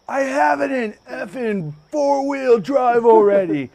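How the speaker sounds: slow, loud, exasperated yell; Mike. Retold or imitated. Driver yelling back from the truck door, exasperated. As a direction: imitate an irritated driver shouting back. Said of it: "I think he cussed".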